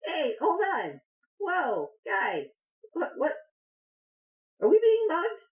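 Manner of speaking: very high, startled halfling voice; alarmed halfling. Acted. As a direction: squeak in alarm at a sudden threat. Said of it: "the halfling definitely stands up and is like".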